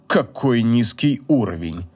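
put-on low, slow, disdainful old man; examine a rare cockroach aloud; cold scorn, drawn out and low. A narrator's reading. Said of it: "таким тоном, будто рассматривал чрезвычайно редкого таракана".

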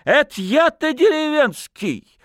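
high-pitched offended outcry; tipsy, affronted yelp; indignant. A narrator's reading. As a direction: protest the insult at the top of your pitch. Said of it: "возмутился гармонист".

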